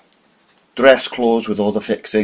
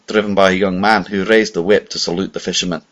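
ordinary delivery

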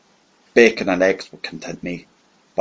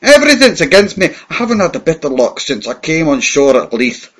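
higher and louder, a worn-out vagrant's complaint; desperate rant; 6 semitones higher, 7 dB louder than usual. Acted. Disguised hero ranting to the villain, loud desperate vagrant. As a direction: pour out every grievance at full voice.

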